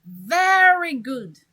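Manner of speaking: drawn-out Very good; stress on very. Shown as a demonstration. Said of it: "This word is to emphasize the thing".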